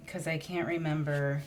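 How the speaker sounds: usual speaking voice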